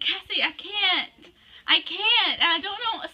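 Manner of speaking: high, loud whine; high-pitched protest to a friend; giving up. Real, not acted. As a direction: plead that you can't go on. Giving up amid giggly bloopers.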